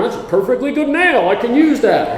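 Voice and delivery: chuffed at a salvaged nail; a hint of a delighted grandpa; thrifty grandpa. Voicing thrifty grandpa over a nail.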